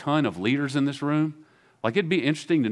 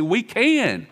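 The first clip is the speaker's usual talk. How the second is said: eager boast; 9 semitones higher, 4 dB louder than usual. Retold or imitated.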